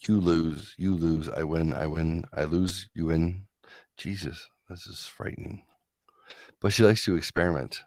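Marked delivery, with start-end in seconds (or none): 4.0-5.6 hushed, frightened whisper. Real, not acted. Whispering to the viewers, genuinely spooked. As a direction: breathe it out, spooked. Scared reaction to test result.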